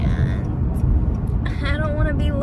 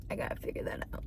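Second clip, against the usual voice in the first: soft whispered aside; 13 dB quieter, much faster than usual. Real, not acted. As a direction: whisper it under breath.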